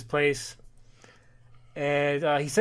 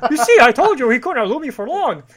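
boastful hero voice; 9 semitones higher, 8 dB louder than usual. Acted. Voicing Dudley Do-Right's boast.

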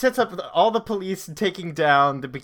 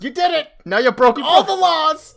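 much higher, louder, slowing hammed-up shout; mock cop yell; 12 semitones higher, 8 dB louder than usual. Retelling the comic's best joke, the cop's hammed-up shout. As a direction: yell it like an outraged cartoon cop. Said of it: "the cop goes".

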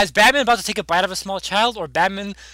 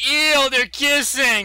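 drawn-out, higher grossed-out whine; mock disgust; 4 semitones higher, much slower than usual. Robin watching Talia kiss, hammed-up disgust. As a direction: play a kid grossed out, stretching it.

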